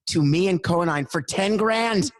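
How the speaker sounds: ten grand rising high with delight; gleeful. Real, not acted. Boasting to the host about the bus price, gleeful.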